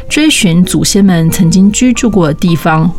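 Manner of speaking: ordinary delivery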